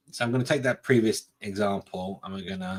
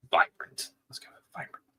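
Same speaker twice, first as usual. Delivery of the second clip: whispering; 5 dB quieter than usual. Real, not acted. Playful whispered color choice.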